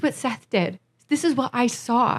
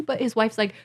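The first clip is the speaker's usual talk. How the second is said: normal talking voice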